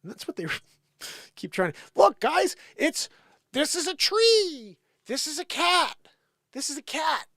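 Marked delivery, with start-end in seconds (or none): 1.9-7.3 high, loud mocking explainer voice; mock patronizing lecture. Mocking critics who insist a cat is a cat. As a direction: talk down like teaching a child. Mocking the other side's demands.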